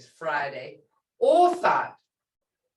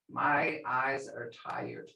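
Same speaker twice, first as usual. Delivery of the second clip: quiet slow weary sigh; soft and slow; 6 semitones lower, 8 dB quieter, slower than usual. Shown as a demonstration. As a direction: let My eyes are tired fade out, softly and slowly. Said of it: "who do shagis".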